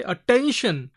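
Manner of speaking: "attention" with ten emphasized; stress on ten. Shown as a demonstration. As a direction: lean on the middle syllable of "attention". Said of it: "So here stress is given".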